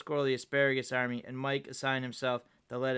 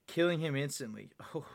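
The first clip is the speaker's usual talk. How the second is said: ordinary delivery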